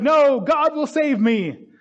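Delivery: high, slow, insistent refusal; stubborn refusal. Retold or imitated. Man on the roof refusing the helicopter, slow and high. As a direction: dig in your heels and refuse.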